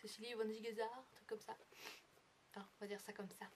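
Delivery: stuffy and sniffly from a cold; sniffling with a cold. Real, not acted. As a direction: speak through a sniffle. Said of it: "je renifle, mais je suis malade".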